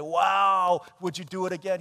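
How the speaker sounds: loud, wowed gasp then an eager plea; mock amazed crowd. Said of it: "for people to say".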